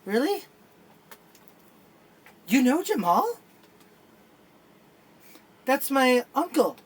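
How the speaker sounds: hearing the news about Jamal, high and amazed; surprised. Retold or imitated. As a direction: react like you just found out. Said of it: "I was like".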